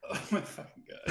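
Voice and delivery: breathless whisper, half laughing; whispered disbelief. Real, not acted. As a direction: whisper it under your breath. Player reacts to awkward scene.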